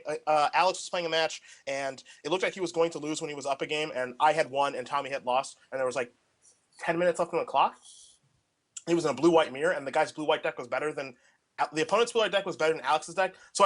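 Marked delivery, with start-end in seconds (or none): none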